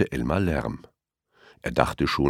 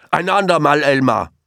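much higher, louder quick dismissal; hasty crocodile; 10 semitones higher, 7 dB louder than usual. A narrator's reading. Crocodile dismisses Elmar before diving, hasty brush-off. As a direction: brush him off on the run. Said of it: "sagte eines der Krokodile".

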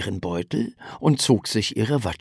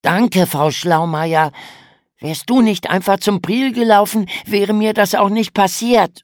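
sarkastisch; 10 semitones higher, 6 dB louder than usual. A narrator's reading. Lukas kontert genervt.

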